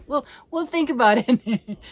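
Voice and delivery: loud laughing repeat. Real, not acted. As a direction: laugh it out, caught out.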